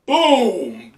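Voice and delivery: mock explosion boom. Explosion in the scene.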